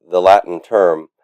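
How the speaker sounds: ordinary delivery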